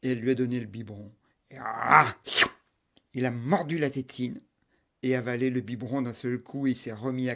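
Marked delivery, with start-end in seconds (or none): 1.5-2.1 loud gulping monster ah. Acted. Monster gulping the bottle, loud ah. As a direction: swallow it in one big gulp.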